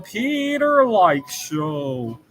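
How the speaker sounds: drawn-out, slow show title; show intro announcement. Pretend. Host opening his own show, drawn-out announcement. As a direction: stretch out the show's name like a jingle announcer.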